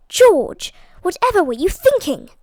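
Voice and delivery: sharp, high, loud cry of dismay; cried out. A narrator's reading. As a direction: cry his name out in alarm. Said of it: "cried Lady Hastings".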